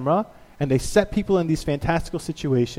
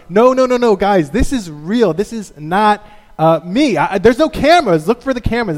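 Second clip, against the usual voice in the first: louder, higher desperate protest; insisting nobody believes him; frantic hijacker; 5 semitones higher, 6 dB louder than usual. Retold or imitated. Hijacker begging passengers to believe, frantic.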